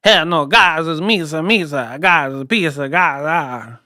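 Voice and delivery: hammed-up foreign accent with sing-song bounce; mock a Kazakh boxer's accented English; pizza-mascot take on a foreign accent; Triple G. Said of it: "That's how Triple G did it".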